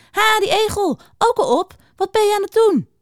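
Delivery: high, chirpy greeting; cheery hare. A narrator's reading. Hare greets hedgehog by the water, high and cheery. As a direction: hail a friend eagerly, pitched well up.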